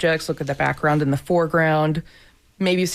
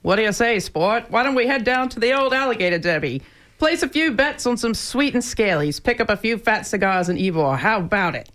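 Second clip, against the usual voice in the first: put-on old Hollywood transatlantic accent, raised pitch; breezy transatlantic accent, like a 1940s movie man; 5 semitones higher than usual. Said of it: "Transatlantic accent".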